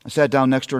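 usual speaking voice

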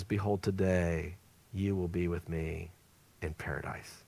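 soft, low, hushed promise from the cross. Retold or imitated. Jesus softly promising paradise to the criminal, hushed and low. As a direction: say it gently, almost a breath, as comfort to a dying man.

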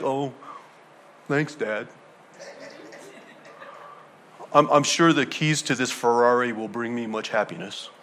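quiet, low, listless thanks; unimpressed child. Acted. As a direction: act out a child underwhelmed by a gift. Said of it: "they're just like".

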